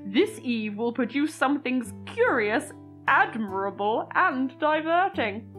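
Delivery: put-on deliberate, theatrical narration of an old letter; dramatic letter reading. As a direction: read it like an old diary entry, savouring words. Reads old letter over music.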